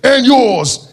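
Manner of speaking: loud, slow hype-man intro. Retold or imitated. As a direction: shout it out to the crowd. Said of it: "It's like".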